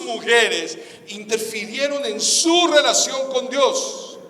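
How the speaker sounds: preacher at full force, drawing the crowd to repeat; fervent preaching. Acted. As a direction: drive each phrase home to the church. Preaching with call and response.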